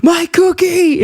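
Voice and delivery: very high, slow cartoon wail; mock a cartoon dad losing his cookie; hammed-up high anguished cry; Homer wailing. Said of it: "turns around and goes".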